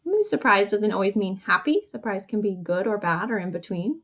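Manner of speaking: ordinary talk, nothing marked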